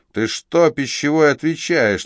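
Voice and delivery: loud, high threatening challenge; bristling thug. Acted. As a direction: square up to the waiter. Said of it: "набычился блондин".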